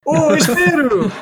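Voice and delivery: high, loud anime cry; overacted anime dub. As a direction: shout it like an anime hero. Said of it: "Sounds like an anime character".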